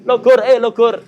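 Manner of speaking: mock startled latah outburst; imitating a startled latah. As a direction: jump and blurt it out like a latah person. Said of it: "Tahu orang latah kan?".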